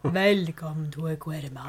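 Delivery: low, laughing horror host voice; a light spooky movie host greeting; creepy Vincent Price voice. Retold or imitated. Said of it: "like, a creepy Vincent Price voice".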